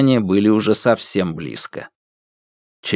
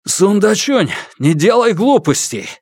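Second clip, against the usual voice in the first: urgent calling out; 10 semitones higher than usual. Acted. Officer calls to father.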